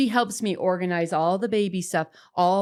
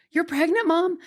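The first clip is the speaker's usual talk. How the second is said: put-on much higher, stunned child's question; astonished kids; 10 semitones higher than usual. Mother retelling her kids' shocked question, much higher. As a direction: voice the kids' disbelief.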